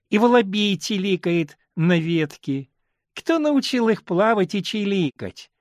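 put-on little girl voice saying "иглают", "лыбки", "лазукласил глуть" with л for р, slow; a small child's lisping л for р in "чиликает"; says l for r. A narrator's reading. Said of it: "как она картавит".

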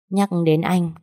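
ordinary talk, nothing marked

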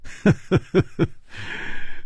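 airy amused chuckle, no voice; breathy laugh. Acted. Laughing at Hu Tiehua before teasing him, airy chuckle. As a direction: let out a soft teasing laugh. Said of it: "季冰燕笑着说".

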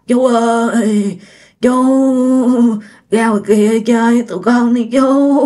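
extremely slow, sticky monotone drawl; eerie, stretched ghost-boy call; creepy slurred ghost.

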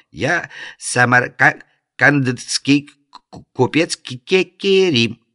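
faked stutter. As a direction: stammer deliberately, rising on the name. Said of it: "старательно заикаясь".

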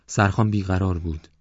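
ordinary delivery

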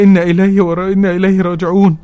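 can't get إنا إليه راجعون out; choked words. Retold or imitated. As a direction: sister stumbling through إنا إليه راجعون in tears. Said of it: "ما كانتش عارفة تقولي".

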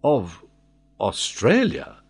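hesitant, puzzled echo; taken-aback repeat. A narrator's reading. Old sailor disconcerted by his master, bewildered echo. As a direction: repeat it haltingly, wide-eyed. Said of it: "repeated Tom, opening his eyes".

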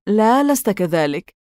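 ordinary delivery, nothing marked

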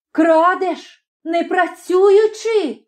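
high, indignant accusation; high-pitched outraged challenge; angry cry. A narrator's reading. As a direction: cry out in unprecedented anger. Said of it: "крикнула".

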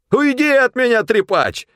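very high, loud squeal; shriek. A narrator's reading. Supply man repelling Pavel who bends over him, shrieking. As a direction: shriek to drive him away. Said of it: "взвизгнул снабженец".

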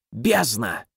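low, soft, displeased raven croak. Acted. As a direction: grumble it like a cranky crow. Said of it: "ворон недовольно каркнул".

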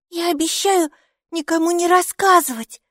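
put-on high childlike promise; a girl earnestly promising; eager little girl. A narrator's reading. Mikey promises mom to keep the secret, high child voice. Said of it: "закричала Майки".

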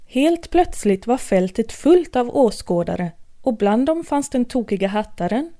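usual speaking voice

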